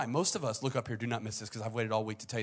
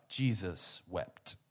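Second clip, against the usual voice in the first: slow solemn reading; 4 semitones lower, much slower than usual. Acted. Preacher reading the shortest verse, slow and solemn. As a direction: let each word hang.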